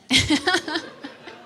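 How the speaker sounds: high, breathy giggle; laugh. Real, not acted. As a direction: break into a giggle mid-thought. Amused after shout-out to friends.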